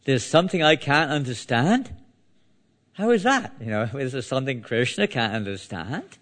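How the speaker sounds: high-pitched disbelief, rising at the end; incredulous questioning. Acted. Krishna baffled by his wife's claim, incredulous. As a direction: question it as if it were impossible.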